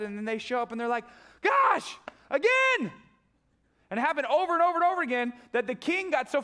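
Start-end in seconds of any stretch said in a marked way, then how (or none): 1.4-2.9 high, loud cry of frustration; exasperated shout. Acted. The foiled king of Aram, shouting in exasperation. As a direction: yell it like you're foiled again.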